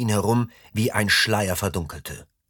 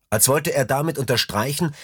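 usual speaking voice

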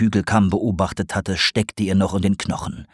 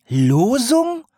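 shocked owl echo. A narrator's reading. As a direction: yelp "Losung" up in shock. Owl echoes.

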